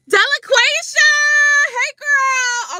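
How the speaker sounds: high, loud call to a friend; excited greeting. Real, not acted. Host greets a viewer in the comments, calling out happily. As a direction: shout her name with joy.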